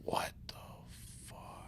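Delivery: breathless stunned whisper; shocked whisper. Real, not acted. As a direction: barely voice it in shock. Shocked at falling ice.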